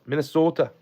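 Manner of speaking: a second try at the Minnesota sound; Minnesota accent. Shown as a demonstration. Testing the Minnesota way of saying it again, lightly. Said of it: "Is that how you say it?".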